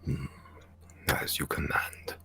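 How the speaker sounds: slow, hushed whisper of assent. Acted. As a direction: murmur obedience slowly. Dragon whispering reply.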